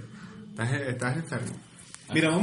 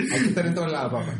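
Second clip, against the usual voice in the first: laughing; 4 semitones higher than usual. Real, not acted. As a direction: laugh while bossing him around. Teasing partner, laughing. (burlón, entre risas)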